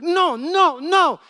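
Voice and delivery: loud, high mock panic; hammed-up cries of refusal; freaking out. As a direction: imitate a man backing away in alarm. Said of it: "freaks out".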